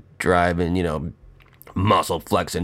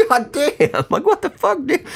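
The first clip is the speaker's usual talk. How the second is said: high, stunned what-the-hell; shocked at a classmate solving it mentally, voice shooting up; incredulous disbelief. Retold or imitated. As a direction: squeak it out in disbelief. Retelling shock at classmate's answer.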